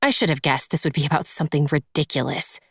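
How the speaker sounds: ordinary delivery, nothing marked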